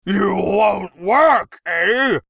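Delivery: loud, high, drawn-out insults; infuriated tirade. A narrator's reading. Infuriated emperor abusing the striking gnome, furious tirade. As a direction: roar each insult with relish.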